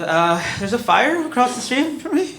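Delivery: high, hesitant caller voice; sheepish high phone voice; timid caller. Retold or imitated.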